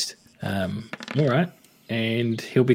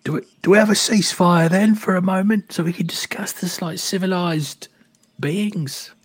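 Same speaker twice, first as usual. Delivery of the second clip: raised, higher-pitched call; moderately raised character yell; 7 semitones higher, 3 dB louder than usual. Acted. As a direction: call out for a truce across the battlefield. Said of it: "Lovejoy yells out".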